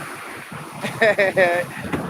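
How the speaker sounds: gleeful laugh at his comeuppance. Real, not acted. Laughs before telling the comeuppance.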